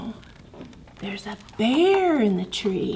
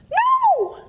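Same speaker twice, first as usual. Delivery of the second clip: much higher squeaky mouse voice; nervous squeak. Acted. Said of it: "squeaked Mouse nervously".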